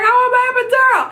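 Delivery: high, fast pleading yell; frantic mock cry for drugs; retold yelling. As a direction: beg for the epidural at full pitch. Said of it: "I kept yelling for the epidural".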